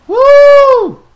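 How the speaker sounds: a long, high, loud whoop; whooping cry. Real, not acted. Gamer breaking free from the monster swarm, loud whoop. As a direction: let out a wild whoop as you break away.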